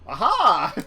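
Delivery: loud triumphant aha. Real, not acted. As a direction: cheer it out loud. GM cheers a success.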